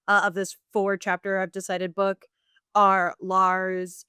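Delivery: normal talking voice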